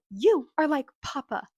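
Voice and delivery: imitated outburst, high and loud; iconic line. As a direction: throw it out like a dramatic accusation. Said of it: "we get the iconic line".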